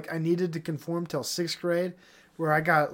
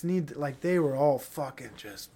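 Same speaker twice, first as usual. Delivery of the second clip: usual speaking voice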